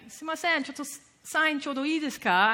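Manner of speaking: a shy fan asking for a signature; polite Japanese fan. Retold or imitated. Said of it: "people would be like".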